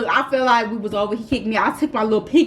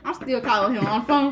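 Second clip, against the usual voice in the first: ordinary talk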